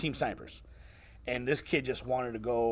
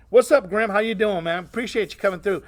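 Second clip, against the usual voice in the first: louder, higher shout-out to an arrival; calling out greeting; 7 semitones higher, 10 dB louder than usual. Real, not acted. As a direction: break off and hail a newcomer loudly. Greeting someone arriving on the stream.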